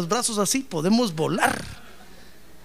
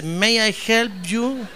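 slow drawn-out "May I help you?" in English; lightly mocking English-accented clerk; 4 semitones higher, much slower than usual. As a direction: imitate a bored clerk's rote greeting. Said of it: "aquí dice".

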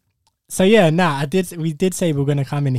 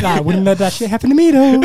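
laughing mock boast; 9 semitones higher, 8 dB louder than usual. Voicing boyfriend's reaction, laughing.

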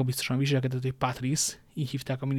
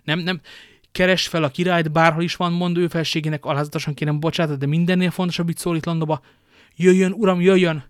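raised, louder voice giving hurried orders; urgent commands; 4 semitones higher, 6 dB louder than usual. Acted. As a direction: call out orders in great urgency. Duke gives urgent orders, leaves.

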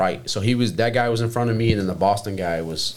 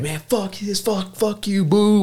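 imitated crazy heckling; 12 semitones higher than usual. Heckler starts up at the game again, high mock ranting. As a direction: play a fan going wild. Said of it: "going crazy".

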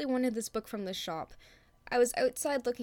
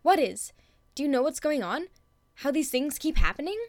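put-on high, louder, pleading girl; desperate, urgent; 6 dB louder than usual. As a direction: beg for answers, desperate. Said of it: "Her voice got desperate, more urgent".

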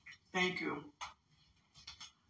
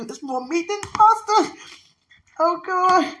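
surprised; 8 semitones higher, 5 dB louder, slower than usual. Real, not acted. Surprised at the food.

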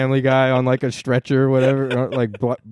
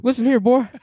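mock Biden to the dog; 11 semitones higher than usual. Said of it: "You're saying it to the dog".

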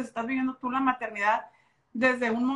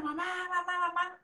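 mild imitation of a wailing toddler, higher and softer; imitating crying child; 7 semitones higher, 4 dB quieter than usual. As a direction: lightly mimic a child crying for mom. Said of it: "no paraba de llorar y de gritar". (imitando a una niña llorando, agudo y suave)

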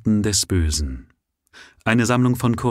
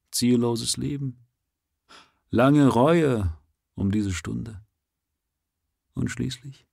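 mournful author's lament; 4 semitones higher than usual. A narrator's reading. The author mourns his burned stories, lightly voiced despair. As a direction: read the lament as a weary, hopeless poet.

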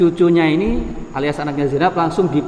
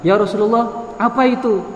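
higher-pitched, mildly astonished quote; lightly acted puzzlement; puzzled question; 6 semitones higher than usual. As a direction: voice a bewildered companion asking. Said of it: "eh, kayak heran".

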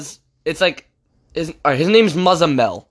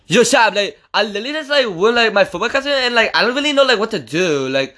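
lisping, higher-pitched kid impression; Muzz; 5 semitones higher than usual. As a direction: imitate a classmate with a lisp. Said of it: "this is how he talks, 'cause I think he has a lisp".

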